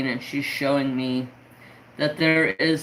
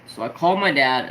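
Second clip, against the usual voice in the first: usual speaking voice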